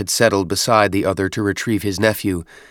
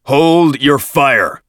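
slow, loud, high command; drawn-out battlefield shout; shouted order; 6 semitones higher, 7 dB louder, much slower than usual. A narrator's reading. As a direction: summon your strength and shout it. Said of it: "gathered his strength and shouted".

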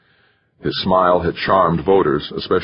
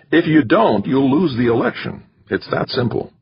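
insistent manager; 8 semitones higher than usual. A narrator's reading. Manager warning the candidate he'll lose, higher and urgent. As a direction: hammer home the consequence. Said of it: "McChesney insisted".

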